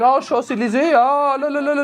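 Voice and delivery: high, lilting French caricature; mock French. Comic imitating audience woman's French side, high mock French. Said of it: "did you see the switch you just did".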